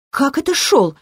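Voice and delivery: high-pitched indignant question. A narrator's reading. Ancient girl protesting her brother's dismissal, indignant. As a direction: object at once, voice pitched high. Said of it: "возмутилась древняя".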